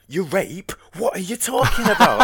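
high, loud, baffled outburst; put-on high voice of disbelief; mock incredulous reaction. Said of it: "they were like".

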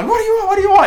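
frantic repeat. Retold or imitated. Frantic repeated question on phone.

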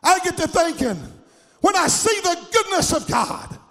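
loud, high, stretched-out shouting praise; preaching at full force. Acted. Preacher shouting to the congregation about God's blessings, full force. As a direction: lift the whole church with a ringing shout.

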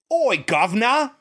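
high, exaggerated mock-British call; hammed-up Cockney greeting with dropped R's; bad British accent. Shown as a demonstration. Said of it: "It sounds like that".

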